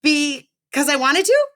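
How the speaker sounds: put-on high-pitched young boy pleading; high kid voice. A narrator's reading. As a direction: play the embarrassed kid, voice up high. Kid answers principal.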